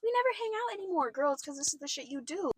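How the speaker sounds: high-pitched mock complaining roommate; mimicked complaint. Roommate complaining they never hang out, mimicked high complaint. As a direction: imitate her, pitched well up. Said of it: "had the audacity to be like".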